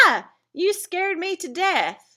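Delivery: as if just jumped at; startled exclamation. Shown as a demonstration. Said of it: "we're saying it with some emotion".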